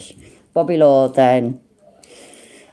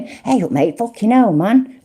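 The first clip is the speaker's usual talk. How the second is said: mock lawyer praise; 7 semitones higher than usual. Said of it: "I'm not doing it verbatim".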